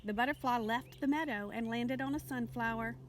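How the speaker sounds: ordinary delivery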